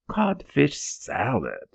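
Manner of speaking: mock disgust at "Codfish salad!", drawn out; sneer. A narrator's reading. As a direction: spit out "Codfish salad!" slowly with contempt. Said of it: "he said with a deep sneer".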